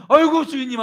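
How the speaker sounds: high, loud yelp of alarm; hammed-up fright; panicked servant. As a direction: cry out as a startled, cowed servant. Terrified servant jumps at master's order.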